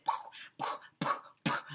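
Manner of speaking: soft voiceless puffs; whispered puffing poof sound effect. A narrator's reading. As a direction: breathe out little poof sounds.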